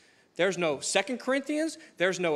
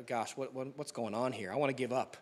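defeated mumble; 7 semitones lower, 8 dB quieter than usual. Retold or imitated. Preacher imagining himself hopeless in the storm, low and soft. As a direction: lower and much softer, giving up. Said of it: "I'm shriveled up in a ball in the back".

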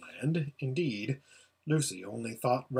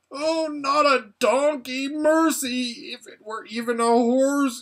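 panicked scream; 10 semitones higher, 5 dB louder than usual. A narrator's reading. Rabadash screaming as he turns into a donkey, high and wailing. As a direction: plead in horror as you change. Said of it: "he screamed out".

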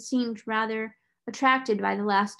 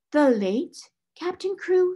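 higher, slow, alarmed cry; 8 semitones higher, 4 dB louder, slower than usual. A narrator's reading. Headmistress crying out to the solicitor, higher and slow. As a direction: cry it out in dawning horror. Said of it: "she cried out".

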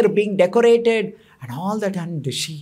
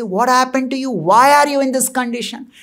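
higher-pitched alarmed questioning; acted alarm; 6 semitones higher, 3 dB louder than usual. A narrator's reading. Husband questions his weeping wife, alarmed. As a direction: demand to know what happened.

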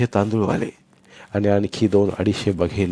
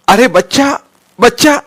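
high, loud anguished cry from the crowd; 11 dB louder than usual. Acted. Onlookers crying out as a baby is struck, loud anguished cry. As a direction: cry out in pain for the child. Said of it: "कळवळ ओरडली".

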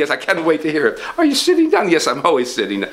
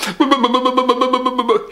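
nervous laugh; 8 semitones higher than usual. Acted. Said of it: "This is my worried look".

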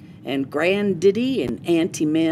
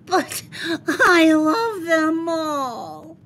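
high, slow, tearful wail; crying child; 10 semitones higher, 3 dB louder, slower than usual. Acted. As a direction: plead through tears for his toys. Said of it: "Spencer cried".

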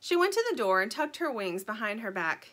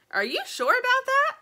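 much higher, louder squawk of doubt; doubtful chicken; 8 dB louder than usual. Acted. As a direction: squawk it in disbelief. Sugar doubting, very high.

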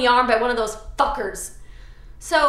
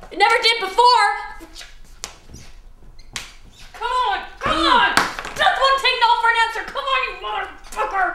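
higher, louder angry outburst; zombie frustration; 10 semitones higher, 4 dB louder than usual. Acted. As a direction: lose it at the makeup.

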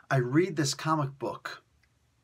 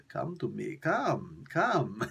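Putin as Dracula; 3 semitones lower, much slower than usual. Acted. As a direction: lure them in like a vampire, a light Russian-Dracula take. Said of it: "why he sounds like Dracula".

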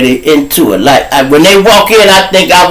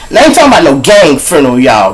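ordinary talk, nothing marked

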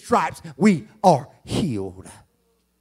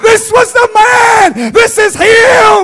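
much higher, much louder whoop, slow with a crying break on the Son of God; preaching at full force; 10 dB louder, slower than usual. Acted. Preacher whooping the centurion's words to the congregation, much higher and louder. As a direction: wail it out to the church.

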